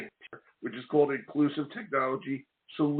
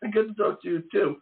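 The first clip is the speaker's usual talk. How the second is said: laughing; 8 semitones higher than usual. Real, not acted. Laughs while greeting guest.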